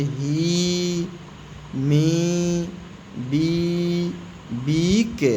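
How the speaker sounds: vowel held long as a big ई in "ही, मी, बी, बीक". Shown as a demonstration. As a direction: stretch the ee in each of "ही, मी, बी, बीक". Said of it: "बड़ी ई का निकलता है". ("ही, मी, बी, बीक" में लंबी, खिंची हुई बड़ी ई)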